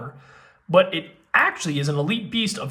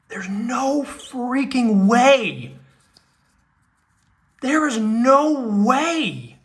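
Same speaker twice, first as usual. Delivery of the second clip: slow, drawn-out, higher disbelief; awed disbelief; 6 semitones higher, 4 dB louder, much slower than usual. Real, not acted. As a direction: savor each word in amazement. Awed at favorite card.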